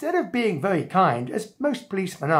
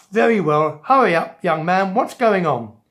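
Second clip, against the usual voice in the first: louder, brusque policeman voice; impatient policeman; 6 dB louder than usual. Acted. Policeman finally hearing out the young man, impatient. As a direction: give in loudly and impatiently. Exasperated policeman gives in to boy.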